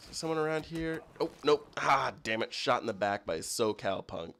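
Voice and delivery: ordinary talk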